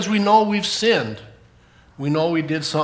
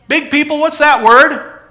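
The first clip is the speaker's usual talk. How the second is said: calling out; 9 semitones higher, 10 dB louder than usual. Real, not acted. Teacher asking the big people for help, calling out. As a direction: call across the room for an answer.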